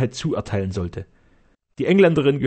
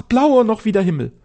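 higher, louder swooning praise; rapturous; 9 semitones higher, 6 dB louder than usual. Acted. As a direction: gush like a lovestruck old man. Castellan raves.

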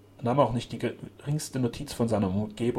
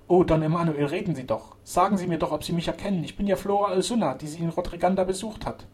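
raised, imploring woman's voice; urgent pleading; 7 semitones higher, 4 dB louder than usual. A narrator's reading. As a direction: plead to be recognized. Flora pleads with sick count.

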